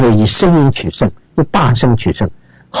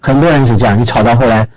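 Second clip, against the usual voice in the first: ordinary talk, nothing marked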